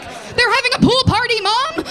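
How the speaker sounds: high, loud guilty squeal; caught child. Retold or imitated. Kid caught with naked Barbies, yelping to mom. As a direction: cover up fast, shrill with guilt. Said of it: "I'm like".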